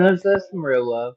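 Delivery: loud, laughing, mock-sentimental; loud laughing sarcasm. Real, not acted.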